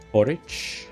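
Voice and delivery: ordinary delivery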